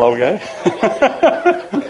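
high, laughing okay; real laughter through the word. Real, not acted. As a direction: laugh it out, genuinely amused. Laughing at grandson's joke.

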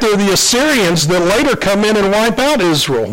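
usual speaking voice

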